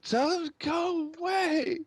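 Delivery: high, loud, drawn-out scream; screaming girl. Acted. Girl upstairs refusing a visitor, screaming. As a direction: scream it out like a distraught daughter. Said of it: "you hear her screaming".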